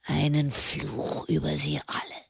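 low, very soft, hoarse cursing; hoarse whisper. A narrator's reading. Prisoner cursing those who condemned him, hoarse whisper. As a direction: curse them all under your breath. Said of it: "flüsterte er heiser".

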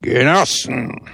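laut, hallend wie aus dem Lautsprecher; donnernde Lautsprecherstimme. A narrator's reading. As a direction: wie der Zorn Gottes über den Platz dröhnen. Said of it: "die jener des Zornes Gottes glich". (Lautsprecher reißt den Priester aus dem Schlaf, donnernd)

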